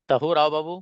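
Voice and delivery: ordinary delivery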